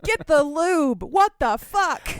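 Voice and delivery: high, loud comic exasperation; hammed-up indignant hollering; mock-outraged shout. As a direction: yell at them in playful disbelief. Scolds characters over lack of lube.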